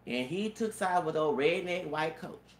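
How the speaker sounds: ordinary delivery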